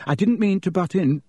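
shaky apology. A narrator's reading. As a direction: apologise nervously, a little high. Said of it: "he said shakily".

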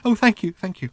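high, bubbling, eager robot voice; gushing little robot. A narrator's reading. The flying robot begging Ford to sit on his lap, high and gushing. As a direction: gush like a lovesick little machine.

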